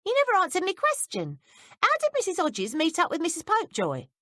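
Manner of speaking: put-on insistent young maid, hurried; quick insistent question. A narrator's reading. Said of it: "Betsy asked quickly".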